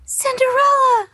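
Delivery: high thrilled cry of a name; starstruck squeal. Retold or imitated. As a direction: call out the name like a fan. Starstruck at seeing Cinderella.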